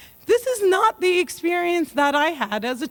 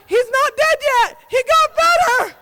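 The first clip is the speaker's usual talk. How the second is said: mock security guard; 10 semitones higher, 5 dB louder than usual. Security mocking dad as Saddam.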